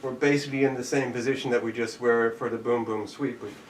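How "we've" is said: normal talking voice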